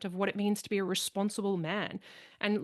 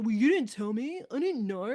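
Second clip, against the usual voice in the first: a hint of whiny childish defensiveness; mildly mocking sulky husband; petulant child; 3 semitones higher, 3 dB louder than usual. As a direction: pout out the excuse like a kid. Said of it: "petulant child attitude".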